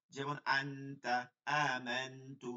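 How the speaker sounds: আনতা drawn out slowly with a nasal hum on ন; slow nasal recitation. Shown as a demonstration. Teacher demonstrates ikhfa to students slowly. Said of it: "ইকফার হরফ আছে".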